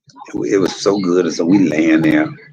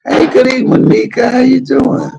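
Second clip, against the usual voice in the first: very high, bright-eyed mock charm; flirty greeting; 3 dB louder than usual. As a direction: greet them like a flirt, pitched way up.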